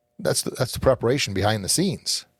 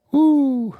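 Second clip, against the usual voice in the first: high, loud whoop of excitement; a real burst of goosebump joy; excited whoop; 6 dB louder than usual. Real, not acted. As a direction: let out a thrilled woo. Thrilled by the movie line.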